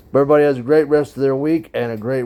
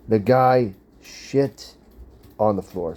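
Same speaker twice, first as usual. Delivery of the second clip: drawn out, each word weighed; telling viewers, slow and emphatic; slow emphatic reveal; slower than usual. Real, not acted. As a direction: let the disgust land slowly. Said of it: "slow emphatic reveal".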